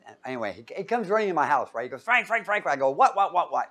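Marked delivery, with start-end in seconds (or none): 2.0-2.7 higher, rapid-fire name calling; imitated excited calling. Friend running into the house, imitated excitement. 2.9-3.7 retold startled reply. Retells startled answer to friend.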